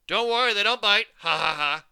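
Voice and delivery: very loud, high joking roar; booming laugh. A narrator's reading. Mover John joking about his moles, booming and laughing. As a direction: bellow the joke and laugh. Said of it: "he shouted".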